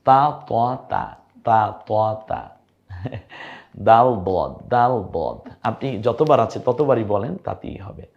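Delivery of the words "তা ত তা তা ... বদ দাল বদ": তা, ত called out loud and slow; letter drill. Shown as a demonstration. Quran teacher drilling letters, loud slow তা and দাল. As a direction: call out the letters slowly for students. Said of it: "এভাবে বলতে থাকুন".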